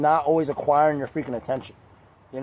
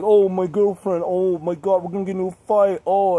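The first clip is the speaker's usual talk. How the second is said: mock fretting; 3 semitones higher, 4 dB louder than usual. Mocks friends fretting over girlfriends.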